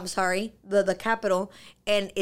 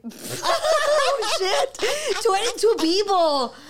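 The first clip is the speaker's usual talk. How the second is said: high, loud, laughing out the words; laughing in disbelief; 11 semitones higher, 5 dB louder, much slower than usual. Retold or imitated. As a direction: laugh through it, stunned at the number. Shock at feeding twenty-two people.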